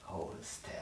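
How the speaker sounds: soft whispered instruction; gently whispered. Acted. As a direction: breathe it gently. Gentle tweezer care.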